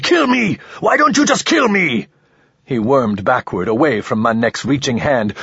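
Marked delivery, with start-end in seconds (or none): 0.0-2.1 desperate scream. A narrator's reading. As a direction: scream it at the captor, much higher. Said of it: "Ramon screamed".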